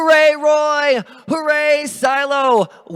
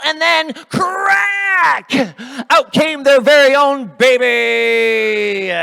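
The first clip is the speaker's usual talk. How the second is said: slow, loud, high excited reveal; dramatic reveal; 6 semitones higher, 7 dB louder, much slower than usual. A narrator's reading. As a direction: build it to a big moment.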